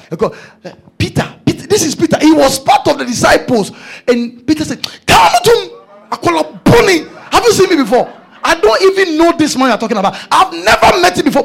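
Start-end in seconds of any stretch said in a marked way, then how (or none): 5.0-11.4 high, loud indignant denial; mock denial shout. Preacher acting out Peter's denial, loud and indignant. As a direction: act out Peter denying Jesus, loud and high. Peter denies knowing Jesus.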